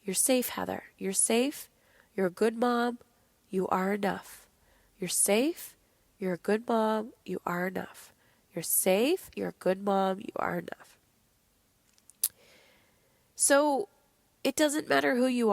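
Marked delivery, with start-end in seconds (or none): 0.0-11.0 soothing mantra that fades to a whisper; calm yourself down, hand on heart; gentle reassurance sinking into a whisper; calming self-talk. Retold or imitated. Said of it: "I had to calm myself down. I had to put my little hand on my heart".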